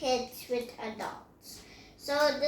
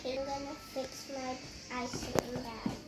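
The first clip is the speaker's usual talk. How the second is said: usual speaking voice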